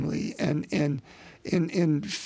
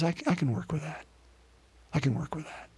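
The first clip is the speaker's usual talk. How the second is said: soft, reassuring divine voice; gentle God; 7 dB quieter, faster than usual. Acted. God reassuring us about our meager gifts, gentle and soft. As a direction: soothe the worried listener softly.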